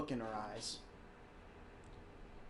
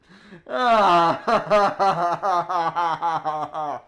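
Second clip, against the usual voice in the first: loud laugh; 3 semitones higher, 13 dB louder than usual. Real, not acted. Laughs at own outburst.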